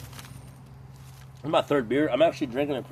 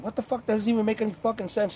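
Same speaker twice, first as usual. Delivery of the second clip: incredulous retort; 10 semitones higher, faster than usual. Retold or imitated. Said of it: "I'm like".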